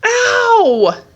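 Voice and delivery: put-on high, loud childish cry of pain; pained yelp. A narrator's reading. Boy tumbling down the hill yelps in pain. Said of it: "the boy said again".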